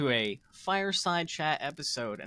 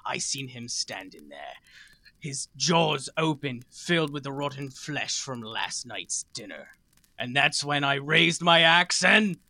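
epic tale telling. Acted. Host opening the show with a mock battle tale, dramatic. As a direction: spin a dramatic barbarian war story.

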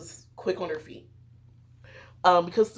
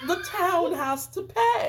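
laughing; 10 semitones higher, 3 dB louder than usual. Real, not acted. Laughing mock villain line.

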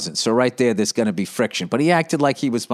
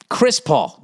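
ordinary talk, nothing marked

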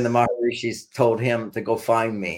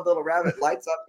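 ordinary talk, nothing marked

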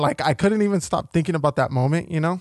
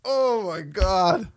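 very high squeaky mock sob; high crying parody, louder; mock blubbering; 6 dB louder than usual. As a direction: wail it like a tearful kid, hammed-up. Mimicking crying voice.

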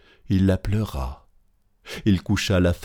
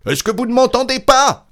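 loud, very high yell; cri à pleine voix; 7 dB louder than usual. A narrator's reading. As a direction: shout with all your strength. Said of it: "cria-t-il de toutes ses forces". (hurlement fort et très aigu)

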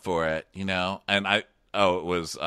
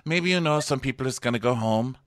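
mock Bachelor accent; 4 semitones higher than usual. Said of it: "he's like".

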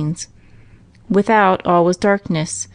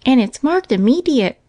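Minnie adding; 6 semitones higher than usual. A narrator's reading. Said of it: "adds Minnie".

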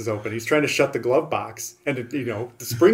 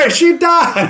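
high, loud blurted shout; comic high-pitched holler; mock outburst; 8 dB louder, slower than usual. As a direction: yelp it as a gag, way up high. Mock outburst quoting a movie's riffers.

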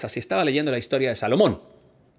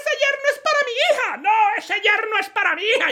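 very high falsetto mother-in-law; quarrelling mother-in-law. Acted. The other mother-in-law claims the son-in-law, very high. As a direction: insist high and fast. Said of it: "Y la otra".

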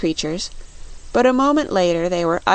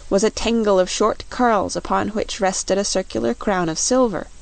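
usual speaking voice

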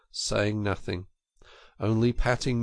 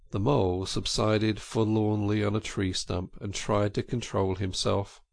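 ordinary delivery

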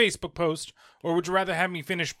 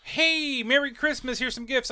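high, bright sing-song hello; higher imitation of a friendly visit; mock cheerful greeting; 8 semitones higher than usual. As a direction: act out a breezy holiday drop-in. Voicing how sister should greet.

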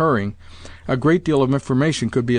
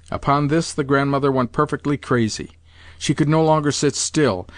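plain reading voice

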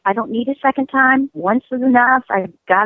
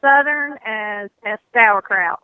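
hammed-up Southern; 3 semitones higher than usual. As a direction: lean into a mild Southern twang. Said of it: "I don't get much more Southern than that".

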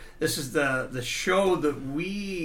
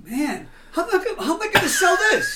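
high, whiny, laughing self-mockery; anguished high whine; 12 semitones higher, 5 dB louder than usual. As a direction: wail it like you can't bear to part. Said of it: "And I".

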